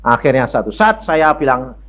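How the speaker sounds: ordinary talk, nothing marked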